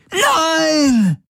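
long high crying scream; anguished scream. A narrator's reading. Garlen tortured by Gaurus, crying high scream. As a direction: let the pain tear out of you.

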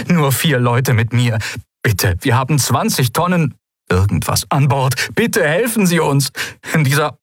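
high, urgent plea by radio; anxious captain, high-pitched over the link; pleading distress caller. A narrator's reading. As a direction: beg for help in a high voice.

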